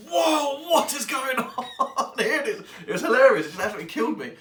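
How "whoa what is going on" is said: high, slow, laughing whoa; surprised exclamation. Retold or imitated. John stumbles on two women squaring off, laughing surprise. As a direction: play the baffled hero catching a standoff. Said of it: "and he's like".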